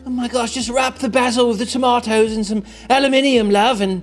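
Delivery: hammed-up British slang rant; exaggerated British accent. Cook letting out the Brit in me, exaggerated British accent. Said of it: "That's the Brit in me".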